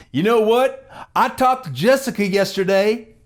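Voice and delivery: loud, high fake phone chatter; mock phone yelling. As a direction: yell as if into a phone. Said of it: "the same volume level that he is".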